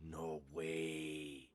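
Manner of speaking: normal talking voice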